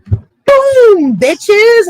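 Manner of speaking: very high, loud triumphant whoop; loud high-pitched boast of a mentor letting loose; Miss Akimi hype shout. Retold or imitated. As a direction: shout it like a mic drop. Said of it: "I've seen Miss Akimi go".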